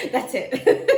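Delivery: high, loud burst of laughter around the words; cracking up. Real, not acted. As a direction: laugh hard while declaring it. Cracks up ending the message.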